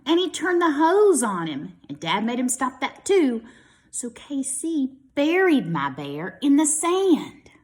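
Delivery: usual speaking voice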